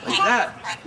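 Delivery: rising from fussy to a high, loud wailing shout; building tantrum. Acted. Parent showing a child how the princess stormed, building to a shout. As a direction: act out a toddler's tantrum getting angrier and angrier. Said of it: "Princess Cloudburst went like this".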